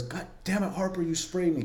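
high, softened imitation of an outburst; mock exasperation. Officer sprayed by a colleague, high retold exasperation. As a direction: imitate an annoyed yelp at reduced volume.

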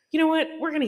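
high excited squeal. Real, not acted. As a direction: burst out, high-pitched. Sudden excited aside.

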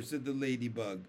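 usual speaking voice

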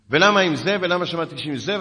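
loud, high mock interrogation; acted-out indignant challenge; retold heated questioning. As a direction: imitate an agitated caller firing demands. Acts out caller's heated questions.

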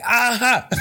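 high-pitched triumphant cry, laughing; exultant high yelp played for laughs; mock triumph. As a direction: imitate a crazed "gotcha" discovery. Chef triumphantly finds the pasta.

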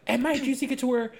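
high-pitched, rapid retold rant; mock yelling best friend. Quoting best friend yelling at her, mock rant. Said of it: "yelling at her, being like".

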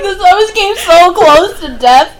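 loud, high, laughing mock lament; mock dramatic quote. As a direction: ham it up while laughing. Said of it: "You're like".

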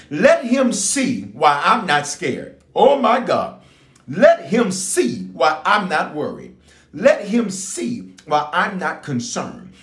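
slow, lifted preaching cadence, each line starting on Let. Acted. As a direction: preach it out line by line over the music. Preaching build with music.

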